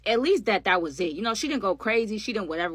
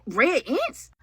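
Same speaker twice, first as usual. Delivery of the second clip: disbelieving question; 6 semitones higher, 5 dB louder than usual. Retold or imitated. Disbelief at ants.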